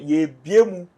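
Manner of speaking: ordinary talk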